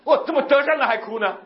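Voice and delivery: high, comically baffled question; mock astonishment. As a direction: exclaim in exaggerated disbelief. Retelling bafflement at their crying. (夸张地惊讶不解)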